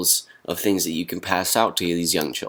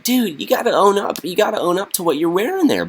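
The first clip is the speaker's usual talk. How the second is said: much higher, faster teasing voice; high mocking scold; 12 semitones higher, 3 dB louder than usual. Vlogger voicing a kid mocking the mask wearer. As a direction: tease him in a high squeaky voice. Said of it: "it's like".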